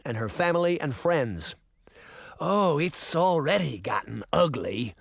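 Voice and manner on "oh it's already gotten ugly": menacing beastly character voice; old man turning into a snarling beast; snarled. A narrator's reading. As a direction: snarl it as a threat. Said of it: "Canis snarled".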